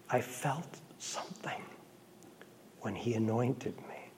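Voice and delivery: breathy whisper, very soft. Retold or imitated. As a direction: whisper it like last words. Dying man's last words.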